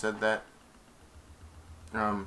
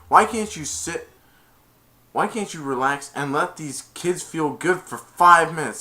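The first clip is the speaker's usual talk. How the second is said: higher, louder pleading challenge; acted exasperation; 6 semitones higher, 7 dB louder than usual. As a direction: demand it of a stubborn coach. Retold argument with coach.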